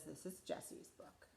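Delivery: soft hushed whisper; gentle whisper to the children; whispered dedication. Acted. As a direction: breathe the dedication like a secret. Reading the dedication softly.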